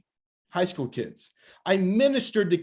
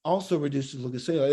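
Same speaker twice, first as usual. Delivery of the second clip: quick, offhand tack-on, slightly lowered; casual sales aside; 3 semitones lower, 3 dB quieter, faster than usual. Shown as a demonstration. Shop owner demonstrating a product pitch, casual quick aside. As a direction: toss it in quickly as a passing extra. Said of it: "You say it like that".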